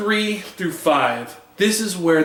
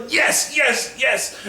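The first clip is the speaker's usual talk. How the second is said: excited cheer; 8 semitones higher, slower than usual. Retold or imitated. Reader cheering at the comic page.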